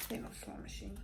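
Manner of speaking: breathy whispered aside; hushed joking whisper. Real, not acted. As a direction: whisper it to yourself in disbelief. Joking denial after reading card.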